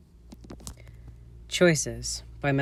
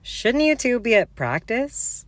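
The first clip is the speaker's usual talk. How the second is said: lightly voiced girl; 11 semitones higher, 4 dB louder, slower than usual. Lily or Mary voiced.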